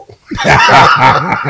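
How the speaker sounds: big, loud burst of laughter. Real, not acted. Host reacting to guest's childhood tale, loud laughter.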